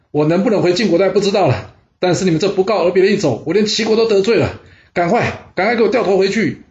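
high-pitched, agitated scolding; agitated protest. A narrator's reading. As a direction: protest urgently, then order a turn back. Acted quarrel, demands to turn back. (又急又气地抗议)

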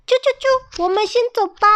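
very high, peeping little chick. Acted. Chicks sneaking off with the cart, in a high peeping chick voice. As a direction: chirp it like a tiny baby chick.

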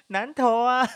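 high burst of laughter; laughing. Real, not acted. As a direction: laugh through the words. Laughing at own pun.

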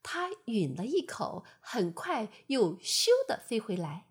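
usual speaking voice

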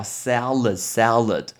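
salad said slowly, ending in a D; final D pronounced. Shown as a demonstration. Teacher drilling learners, salad said slowly with its final D. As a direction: repeat salad slowly as English SAL-ud, keep the D. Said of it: "那个D一定要念".

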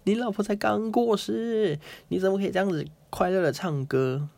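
high, scandalized scolding voice; shocked friend. Retold or imitated. Friend scolding Zhuangzi for singing after his wife died, high shocked scolding. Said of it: "他的朋友就吓到，就说".

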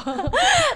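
high-pitched burst of laughter. Real, not acted. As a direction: squeal with laughter. Laugh after joke.

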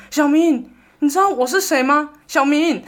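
high, loud anxious mother calling her son's name; worried mom calling out. Retold or imitated. Mom at the bedside calling her son, loud and high. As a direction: call his name like a frightened mom, laughing through it. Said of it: "然后就一直说".

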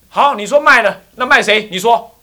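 high, loud demanding voice; loud confrontation; loud challenge. Acted. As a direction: press them for an answer. Confronting the old couple.

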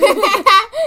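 big loud burst of laughter. Real, not acted. Mother bursting into loud laughter at her teasing. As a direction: crack up loudly.

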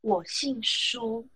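我姓苏 said very slowly; slow, drawn out. Retold or imitated. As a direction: draw out 我姓苏 deliberately. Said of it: "然后他就说". (慢慢地说我姓苏)

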